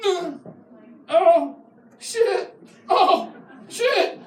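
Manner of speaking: high strained groan and curse; hammed-up back pain cry; mock pained groan. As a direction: yelp it like your back just went. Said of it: "you're like".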